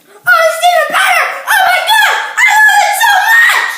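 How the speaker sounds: high, very loud, gushing cat voice; excited cat. Retold or imitated. Kid imagining his cat tasting the strudel, high loud excited squeal. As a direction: squeal it like an overjoyed pet. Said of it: "he'd probably be like".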